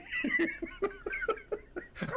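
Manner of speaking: a sudden high burst of laughter. Real, not acted. Preacher cracking up at his childhood story, high laugh.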